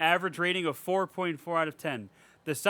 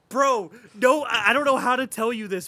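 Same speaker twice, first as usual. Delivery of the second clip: exasperated; 4 semitones higher, 6 dB louder than usual. Real, not acted. Exasperated rebuttal to pretentious critic.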